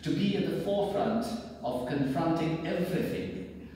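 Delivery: solemn, declaiming address to fellow artists; stately ceremonial oration; formal speech. Acted. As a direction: read it like a grand public proclamation, rising to loud climaxes. Reads a formal message aloud.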